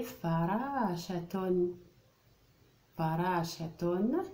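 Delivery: slow, stretched فراشة; drawn-out word. Shown as a demonstration. Teacher presenting the word to the class, drawn out. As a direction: draw فراشة out for pupils to hear. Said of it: "teacher sounds out the word".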